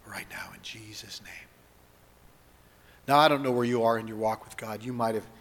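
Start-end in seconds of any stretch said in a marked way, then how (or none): 0.1-1.5 soft whisper to close the prayer. Acted. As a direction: breathe it as an intimate whisper.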